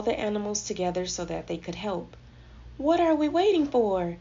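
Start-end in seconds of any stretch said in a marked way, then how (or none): 2.8-4.2 higher, louder urgent mouse cry. A narrator's reading. Timothy Mouse to the animals, urgent cry. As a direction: cry out to rally the others. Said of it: "Timothy Mouse cried".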